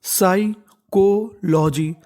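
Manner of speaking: Psychology stretched out very slowly, syllables separated; teacher sounding out psychology slowly. Shown as a demonstration. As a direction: drag out psychology so learners can follow. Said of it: "तो meaning बन गई".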